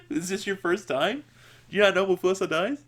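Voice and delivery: high-pitched incredulous question; high, amused disbelief; mock disbelief. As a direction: tease them with feigned surprise. Mock question to crying viewers.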